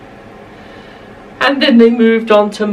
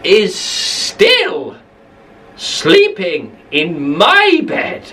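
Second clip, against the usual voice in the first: put-on Baby Bear voice; much slower than usual. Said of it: "Baby Bear walked over to his bed and said".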